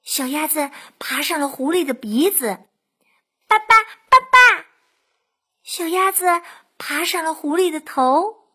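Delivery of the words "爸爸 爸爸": high, loud duckling cry; duckling calling out. A narrator's reading. Duckling climbing the fox's nose, calling high and loud. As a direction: pipe up loudly as a baby duckling.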